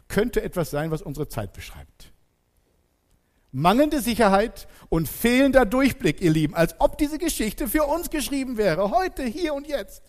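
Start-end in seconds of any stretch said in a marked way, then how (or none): 3.5-10.0 raised, emphatic preaching voice; urgent sermon climax, pitched up; preaching at full force. Real, not acted. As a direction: drive the point home loud and high. Preacher stresses uncertainty of our time.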